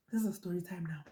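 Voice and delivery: hushed aside to viewers; whispered aside, barely voiced. Real, not acted. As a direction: drop to a whisper.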